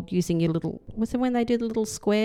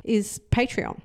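normal talking voice

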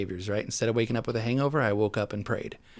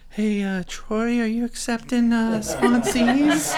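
high, timid, drawn-out newcomer voice; shy newcomer; 13 semitones higher, 8 dB louder, slower than usual. Retold or imitated. Asking his future sponsor on day three, high and timid. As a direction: ask like a nervous new guy, stretching it out. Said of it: "like, kinda shy".

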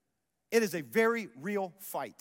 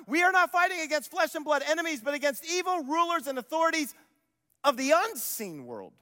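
loud, driving scripture read; preaching at full force; 7 semitones higher, 6 dB louder than usual. Acted. Pastor preaching Paul's words to the congregation, at full force. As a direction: thunder the verse to the church.

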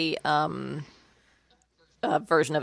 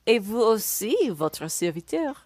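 flawless schoolgirl French; 4 semitones higher than usual. Shown as a demonstration. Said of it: "Viola responding in flawless schoolgirl French".

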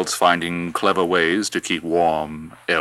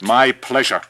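roaring tyrant king; 7 semitones higher, 8 dB louder than usual. Acted. Herod answers servant's question.

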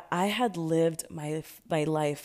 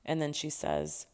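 ordinary talk

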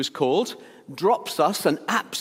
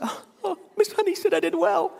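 imitating a teary girl. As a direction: play a gushing little girl near tears. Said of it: "girls would cry".

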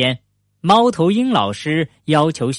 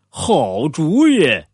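drawn-out, keen agreement; approving; much slower than usual. A narrator's reading. Bear welcomes the bike challenge, drawn-out approval. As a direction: stretch it slowly with mild enthusiasm.

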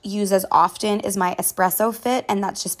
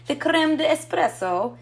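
a quick, playful Italian accent on creme de espresso, pitched higher; 7 semitones higher than usual. Shown as a demonstration. Showing viewers her espresso creamer, in a playful Italian accent. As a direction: roll out creme de espresso like a mock Italian barista. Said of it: "I don't know why I was Italian there".

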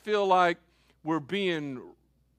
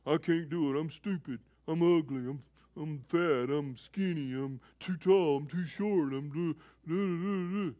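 lightly voiced self-pitying child, softer and lower; whiny child; 3 semitones lower, 4 dB quieter than usual. Children putting themselves down, lightly voiced mock whine. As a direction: mope through the list like a sulky kid. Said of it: "your children say".

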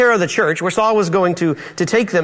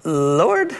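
"Lord" with a rising question at the end; 3 semitones lower than usual. Shown as a demonstration. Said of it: "I'm gonna say it as, like, a, a question".

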